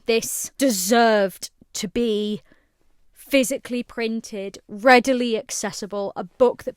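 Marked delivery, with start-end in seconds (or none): none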